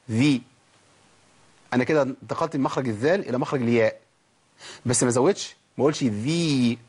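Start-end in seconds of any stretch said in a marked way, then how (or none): 0.1-0.4 ذي said short, not lengthened to ياء; a crisp ذي without adding a ya; dhal with kasra. Shown as a demonstration. Teacher asks student to try: short ذي. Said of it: "قول كده".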